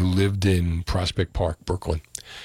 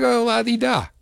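high-pitched, singsong mockery; mock carefree; 12 semitones higher than usual. Host mimics the friend who shrugged off the theft, mock carefree. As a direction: mimic someone breezily unbothered.

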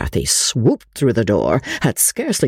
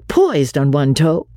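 plain reading voice

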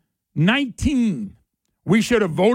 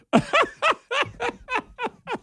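laughing; 4 dB quieter than usual. Real, not acted. Host cracking up.

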